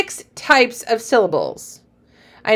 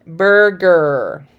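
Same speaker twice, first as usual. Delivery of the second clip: "Burger" drawn out in two parts, bur-ger; broken at the syllable; 2 semitones lower than usual. Shown as a demonstration. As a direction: stretch both halves of "Burger". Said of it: "where the break is gonna be".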